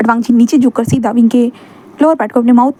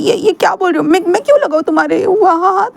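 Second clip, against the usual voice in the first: higher whiny voice, hurt, building to anger; sulky young man; 7 semitones higher than usual. Acted. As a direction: pout and complain like a wounded boy. Wing voiced, shocked.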